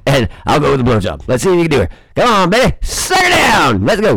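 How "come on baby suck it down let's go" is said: drawn-out shout, much higher; mock hype shout. Daring his listener on, drawn-out hype shout. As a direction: egg them on, stretched and yelling. Goading an imagined partner on.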